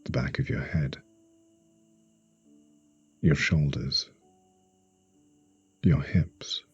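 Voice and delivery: slow meditation voice with long pauses; guided sleep hypnosis. Acted. Hypnotherapist guiding a listener to relax for sleep, slow and soothing. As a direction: ease the listener into stillness, phrase by phrase.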